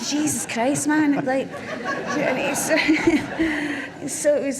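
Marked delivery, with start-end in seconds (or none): 0.1-1.2 high, loud laughing protest; exasperated outburst through a laugh; retold exasperation. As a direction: act out comic disbelief. Retold exasperation at financier's obsession.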